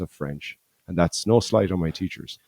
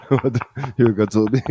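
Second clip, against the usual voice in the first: French wolf voice; 5 semitones higher than usual. Retold or imitated. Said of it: "French Big Bad Wolf".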